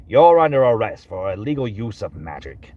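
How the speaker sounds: low, stern detective voice; Almond Cookie. Acted. Detective arresting someone for illegal magic, low and stern. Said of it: "I can do Almond Cookie".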